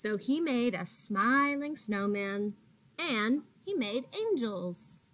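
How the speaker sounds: gentle, expressive read-aloud voice; reading to children. A narrator's reading. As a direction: read it like a picture book for little kids.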